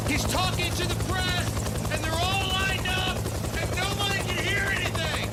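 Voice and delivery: high-pitched yell over helicopter roar; shouting over noise. Pretend. Host describing the press by the helicopter, shouting over it. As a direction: strain to be heard above the rotors.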